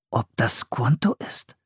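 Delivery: whispered wondering; whispering to himself. Acted. Philipp whispering a guess about the stranger. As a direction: muse under the breath. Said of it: "überlegte Philipp".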